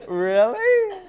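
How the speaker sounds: high, disbelieving really; incredulous really. Real, not acted. Reacting to his own joke with high disbelief. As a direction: pitch it up in disbelief.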